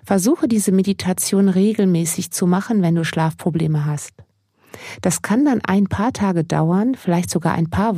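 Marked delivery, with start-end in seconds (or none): none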